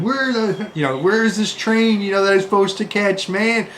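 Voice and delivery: panicked shout across a train station; mock madman yell. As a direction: yell it like a lost traveller losing it. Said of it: "screaming like a madman".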